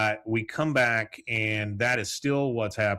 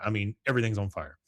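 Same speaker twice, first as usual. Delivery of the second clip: ordinary talk, nothing marked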